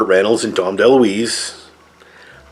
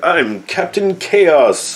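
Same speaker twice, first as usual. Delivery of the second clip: higher, slow hammed-up hero announcement; lightly voiced goofy alter ego; mock superhero; 8 semitones higher, much slower than usual. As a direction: puff up like a cheesy caped hero. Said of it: "and he's like".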